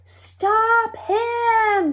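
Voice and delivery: high, loud alarmed shout. A narrator's reading. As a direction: yell it out to stop the weasel. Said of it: "shouted Dot".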